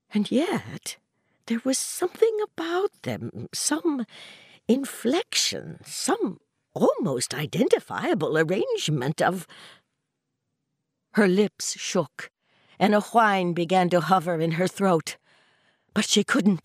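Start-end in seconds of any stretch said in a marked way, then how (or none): none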